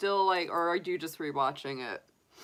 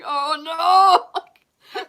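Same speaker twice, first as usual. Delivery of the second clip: dismayed; 11 semitones higher, 11 dB louder than usual. Retold or imitated. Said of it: "I'm like".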